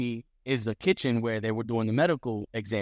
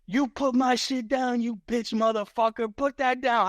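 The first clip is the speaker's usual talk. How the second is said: imitating angry Chucky; 10 semitones higher than usual. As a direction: shriek it like a furious rival. Chucky yelling over his bag.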